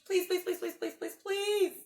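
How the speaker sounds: high, rapid pleading pleases; mock begging. Grover begging the children not to turn the page. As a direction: beg like a frantic puppet.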